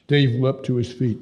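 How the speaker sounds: plain reading voice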